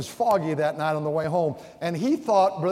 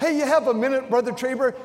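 mock pestering asker; 9 semitones higher, 5 dB louder, faster than usual. Imitating people asking for counsel.

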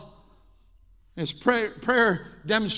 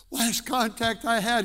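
normal talking voice

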